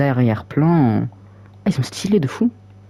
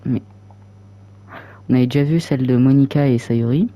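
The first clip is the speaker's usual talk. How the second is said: ordinary talk, nothing marked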